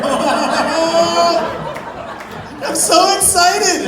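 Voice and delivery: high, loud, drawn-out squeal of joy; giddy excitement. Acted. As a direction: imitate a buddy bursting with anticipation. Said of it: "He's so excited".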